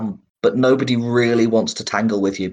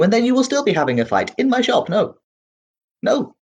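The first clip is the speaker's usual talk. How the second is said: much higher, rattled and insistent; a nervous little merchant protecting his business; halfling shopkeeper anxiously refusing an ambush in his shop; agitated shopkeeper; 8 semitones higher than usual. Acted.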